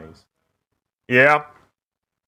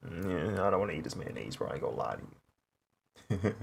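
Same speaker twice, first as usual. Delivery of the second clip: reluctant inner voice; 3 semitones lower, 10 dB quieter, faster than usual. Retold or imitated. Quoting own reluctant inner voice.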